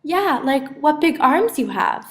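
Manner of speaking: put-on high, loud young girl voice; a bright little girl questioning her grandma; little girl Cindy, puzzled. A narrator's reading. Cindy telling the wolf-grandma she looks different, high and loud.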